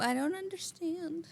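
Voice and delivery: high, slow, playful plea; mock helpless. Joking about baffling dialect words, mock helpless. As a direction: put on a helpless little voice.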